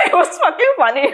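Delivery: breaking into a high laugh; giggling through the words. Real, not acted. Laughing over a funny story.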